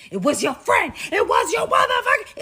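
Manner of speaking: high, full-volume acted outburst; furious shout. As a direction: explode into a screamed accusation. Driver explodes at her, acted.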